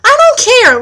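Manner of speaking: high, loud I don't care; angry outburst. Real, not acted. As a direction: snap it out in real anger.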